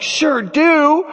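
high, drawn-out excited reply; over-eager kid saying yes; eager acceptance. Retold or imitated. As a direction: jump at the invitation. Eagerly accepts uncle's fishing invite.

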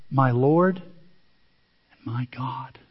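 slow, low, reverent; awed confession. Acted. Thomas confessing to the risen Jesus, slow awe. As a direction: breathe it out slowly in awe.

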